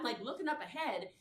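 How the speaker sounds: normal talking voice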